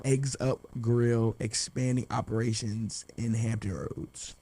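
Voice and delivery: slow, word-by-word announcement of "Eggs Up Grill"; slow, careful repetition. Real, not acted. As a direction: spell it out slowly for confused listeners. Repeats it for confused listeners.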